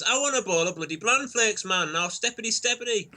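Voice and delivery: put-on high-pitched, comic kid demand; playful mock bossiness, ending near laughter; mock insistence. His six-year-old self insisting on bran flakes.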